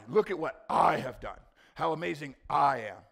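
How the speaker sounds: loud boast with "I" stressed. Shown as a demonstration. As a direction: lean hard on each "I", loud and boastful. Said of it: "the emphasis in this verse is on the I".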